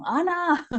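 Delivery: high, drawn-out mock call of a name; imitated calling shout. Voices waking her up by name, imitated shout. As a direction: call out as if waking someone, without full volume. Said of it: "me gritaban".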